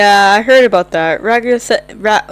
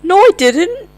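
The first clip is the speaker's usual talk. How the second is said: high squeaky denial; 9 semitones higher, 4 dB louder than usual. Acted. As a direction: deny it in a high voice. Character denies accusation.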